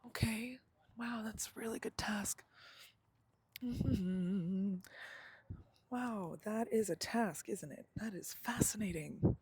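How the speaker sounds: high, breathy mock wonder, easily distracted; mock fascination. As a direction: marvel at the task instead of doing it. Acting out her distracted self.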